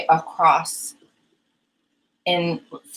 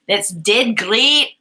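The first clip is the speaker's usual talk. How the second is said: slow, drawn-out attempt at Scottish; Scottish accent; 3 semitones higher, 4 dB louder, much slower than usual. Shown as a demonstration. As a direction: have an amateur go at a Scottish accent. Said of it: "to say in a Scottish accent".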